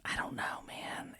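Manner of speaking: soft unvoiced whisper. Real, not acted. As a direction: breathe it like a secret. Whispered reply in hushed aside.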